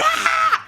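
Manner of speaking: high short shriek of panic; a sudden high yelp; shrieking scream. Retold or imitated. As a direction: scream like she lost her mind. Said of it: "lost her mind".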